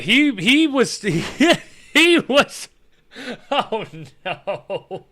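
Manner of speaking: breaking up with laughter at the memory; laughing while talking. Real, not acted. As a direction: laugh through the words, losing the thread. Cracks up recalling the friend.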